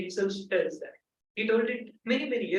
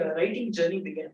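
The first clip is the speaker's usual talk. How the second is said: ordinary talk, nothing marked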